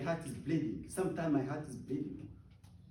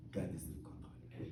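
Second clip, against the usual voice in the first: whispered, much softer and lower; hushed whispered conviction; 10 semitones lower, 12 dB quieter than usual. Real, not acted. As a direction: breathe it out like a secret assurance. Repeat falls to whisper.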